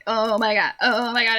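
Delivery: ordinary talk, nothing marked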